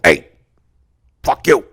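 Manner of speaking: loud, punched rough-guy voice; Mike character voice. Acted. Performing the story for friend's mom, loud character voice. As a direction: bark it out in character. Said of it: "I do the voice".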